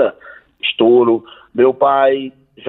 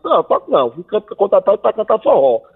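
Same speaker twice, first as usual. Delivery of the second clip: higher, defiant refusal; trying to sound tough; 8 semitones higher than usual. Retold or imitated. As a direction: play the tough guy, a bit forced. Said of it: "papai queria ser brabo e disse". (fingindo ser brabo)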